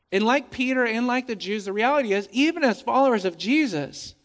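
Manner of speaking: usual speaking voice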